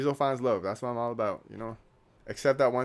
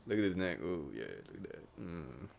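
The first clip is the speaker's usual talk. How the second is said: weird admiring voice; 4 semitones lower, 9 dB quieter than usual. Pretend. Vlogger showing off his neck, in a weird low admiring voice. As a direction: drop low and soft into a mock-savouring "ooh, mm". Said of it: "That was really weird".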